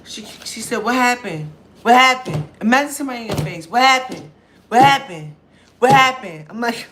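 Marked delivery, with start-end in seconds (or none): 0.8-2.5 loud, high, in-your-face demanding; drunk woman's pushy questioning. Retold or imitated. Drunk woman at liquor store pestering them, loud and high. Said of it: "That's how she's talking". 3.7-6.4 loud, high, in-your-face demanding; drunk woman's pushy questioning. Retold or imitated. Drunk woman at liquor store pestering them, loud and high. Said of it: "That's how she's talking".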